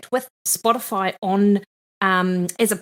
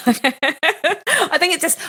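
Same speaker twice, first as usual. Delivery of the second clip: laughing; 9 semitones higher, much slower than usual. Real, not acted. Teased about first-name basis.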